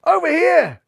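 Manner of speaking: high, loud call across the field; yelling over here; yelled. A narrator's reading. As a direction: shout to the little rabbit. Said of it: "yelled Buntham".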